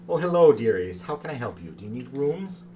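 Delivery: man doing a woman's voice; lightly voiced creaky granny; creaky warbling old lady. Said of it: "in this creaky, sort of warbling little lady voice".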